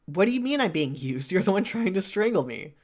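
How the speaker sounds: mildly acted indignation, voice lifting; indignant retort. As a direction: throw it back as an affronted challenge. Chosen reply acted in quarrel.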